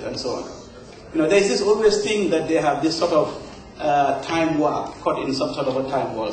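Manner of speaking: ordinary delivery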